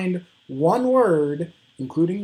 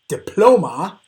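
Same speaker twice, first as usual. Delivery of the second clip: diploma as di-PLO-ma, PLO punched louder; stress on middle syllable; 3 semitones higher, 7 dB louder than usual. Shown as a demonstration. Teacher offers second option to students, diploma stressed on PLO. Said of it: "it's that middle one".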